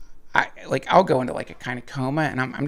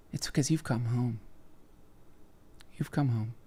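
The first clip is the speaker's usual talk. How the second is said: softer, lower, hushed welcome; soft mock-spiritual; 3 semitones lower, 11 dB quieter than usual. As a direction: say it like a gentle revelation. Mock-spiritual reveal to viewers.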